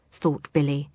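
ordinary delivery, nothing marked

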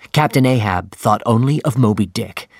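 plain reading voice

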